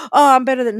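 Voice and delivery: loud, snooty mock of a proud kid; arrogant boast. Preacher mocks a child's arrogant boast, loud and snooty. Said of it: "carry pride like".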